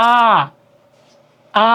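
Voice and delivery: আ as a long, open "aah"; আ held alone, wide and clear; open vowel demo. Shown as a demonstration. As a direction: say আ with the mouth fully open. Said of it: "মুখ বিবর সম্পূর্ণ উন্মুক্ত থাকে".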